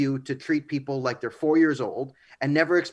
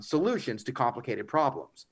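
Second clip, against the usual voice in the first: ordinary delivery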